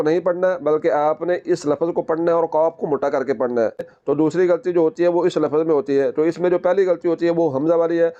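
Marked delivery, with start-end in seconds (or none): none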